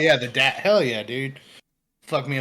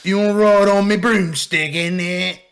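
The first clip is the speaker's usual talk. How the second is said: higher, loud cockney lad; mock British; 7 semitones higher, 9 dB louder than usual. Clowning a British Harry Potter reading, higher and loud. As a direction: sell the broomstick cheekily.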